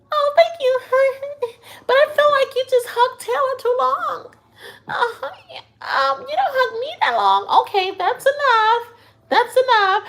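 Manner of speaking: very high, squeaky put-on voice; mock needy girl. Mimics needy housemate's jealous reply.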